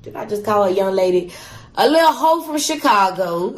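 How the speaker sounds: ordinary delivery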